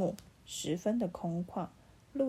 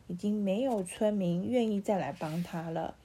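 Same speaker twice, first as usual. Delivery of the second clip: usual speaking voice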